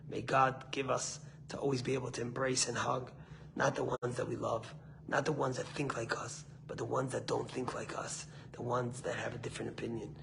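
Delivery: soft, low, solemn benediction; closing prayer. Real, not acted. Closes with a prayer.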